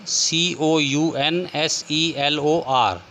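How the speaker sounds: letters spaced out slowly; teacher spelling a word slowly for students. Shown as a demonstration. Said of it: "American English में कैसे लिखेंगे".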